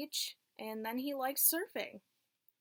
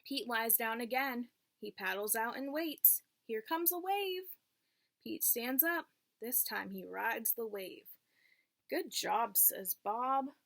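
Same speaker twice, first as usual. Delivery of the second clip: clear, lilting read-aloud voice for a class; teacher reading a picture book to the class; reading to children. Acted. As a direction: read it like storytime for little kids.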